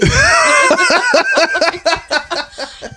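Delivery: laughing out loud; big burst of laughter. Real, not acted. Laughter after ad line.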